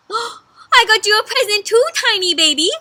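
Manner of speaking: high, loud, eager horse voice; excited gift-giver. A narrator's reading. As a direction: burst out with your own present. Horse eagerly offers gift back.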